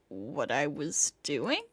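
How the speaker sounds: low, slow and soft; dazed. Acted. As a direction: murmur it, lost and confused. Dazed, confused reply.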